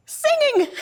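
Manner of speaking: high, loud cry of astonishment; amazed exclamation. Acted. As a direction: exclaim it in disbelief, pitch leaping way up. Amazed that mother is singing.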